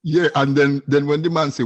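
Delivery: ordinary talk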